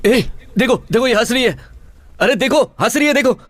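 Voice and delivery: high, urgent exclamations; high-pitched, insistent pointing-out; frantic alerting. Acted. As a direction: urge everyone to look, pitch shooting up. Urgently pointing out her laughing.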